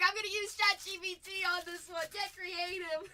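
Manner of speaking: loud laughing impression of a viewer; mock viewer voice, laughing. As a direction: act out the viewer, amused and loud. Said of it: "He's like".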